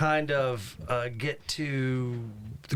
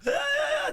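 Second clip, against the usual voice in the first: mock scream. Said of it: "then he takes a bite of her leg".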